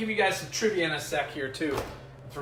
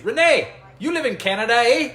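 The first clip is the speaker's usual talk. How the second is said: loud, high Canadian-style hail; mock Canadian accent; 7 semitones higher, 10 dB louder than usual. Host hailing a viewer about Canada, loud mock Canadian accent. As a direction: call out loudly with a Canadian "eh". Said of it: "should we start speaking Canadian".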